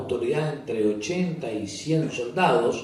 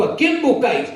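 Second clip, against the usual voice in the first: word of authority; 14 semitones higher, 8 dB louder than usual. Acted. Jesus to the soldiers who come for him, commanding loud call. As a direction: ring out high and loud with authority. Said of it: "esa palabra de autoridad".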